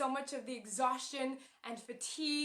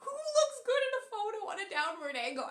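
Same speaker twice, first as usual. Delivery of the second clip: high, loud mock-crying whine; hammed-up sobbing complaint, pitched way up; tearful wail; 11 semitones higher, 7 dB louder than usual. Pregnant woman crying to her partner over bad photos, tearful wail. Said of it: "I burst into tears".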